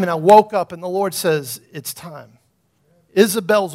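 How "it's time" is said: much softer, lower solemn whisper of "It's time"; hushed divine voice. Retold or imitated. Pastor voicing the Lord waking him, hushed and low.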